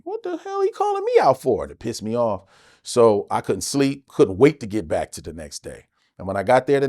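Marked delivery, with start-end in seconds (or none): 0.0-1.6 indignant inner voice. Retold or imitated. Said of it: "And I'm like".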